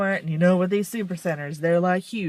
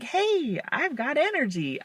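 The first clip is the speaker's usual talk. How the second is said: much higher, bubbly fake pep; fake cheerful; 8 semitones higher than usual. To people, faking cheerful energy. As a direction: put on a bright fake energy. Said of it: "because I act like this".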